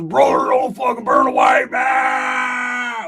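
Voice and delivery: high, loud, slow wailing; whining plea. Acted. Roach begging not to be burnt, wailing. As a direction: wail the plea.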